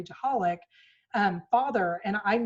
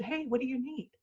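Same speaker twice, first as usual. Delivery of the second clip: softer, tender question; gentle and kind; 4 semitones higher, 7 dB quieter than usual. Shown as a demonstration. Mom opening the door to her daughter, tender and soft. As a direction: welcome her warmly at the door. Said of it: "Same words, completely different tone".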